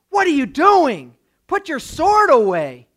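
incredulous, forceful telling-off; acted rebuke. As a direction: rebuke a friend in exasperation. Jesus rebukes Peter drawing sword.

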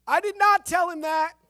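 loud, high, offended retort; indignant voice from down the hall. A narrator's reading. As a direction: call out from another cell, fed up. God's voice from another cell.

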